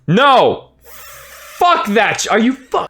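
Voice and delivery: sharp high angry burst; furious fan outburst; heated outburst. Real, not acted. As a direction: cut yourself off mid-rage. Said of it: "I'm getting really heated".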